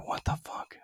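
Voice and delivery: hushed, breathy what the fuck; whispered disbelief. Retold or imitated. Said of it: "And I'm like".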